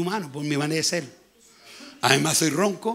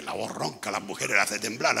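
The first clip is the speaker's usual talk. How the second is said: much lower, softer voice; low-voiced joke; 8 semitones lower, 5 dB quieter than usual. Preacher retelling his joke about his hoarse voice, low and soft. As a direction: drop it low and quiet like a wry aside.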